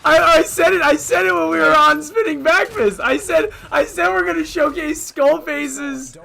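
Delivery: excited loud insistence. Real, not acted. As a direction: say it loudly and keenly. Excited defense after the laugh.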